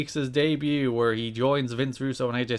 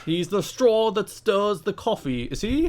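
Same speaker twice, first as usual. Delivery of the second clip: mock New York accent; 6 semitones higher than usual. Host sends up the wrestler's line in a mock New York accent. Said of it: "a New York accent".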